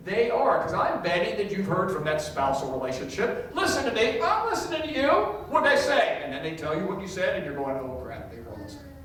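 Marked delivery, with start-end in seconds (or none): 3.5-6.2 high, loud nagging spouse voice; mock nagging spouse. Pastor imitating a spouse insisting they listen. 7.8-8.9 mock muttered dismay. Caught-out partner, muttered.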